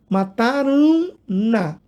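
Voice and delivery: "Mataram na" slowly, the nasal carried into "na"; steady, careful "Mataram na"; slow model reading. Shown as a demonstration. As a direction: model the correct form slowly. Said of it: "questão até de eufonia".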